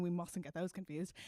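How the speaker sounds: usual speaking voice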